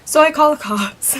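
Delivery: normal talking voice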